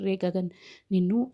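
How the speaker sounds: ordinary delivery, nothing marked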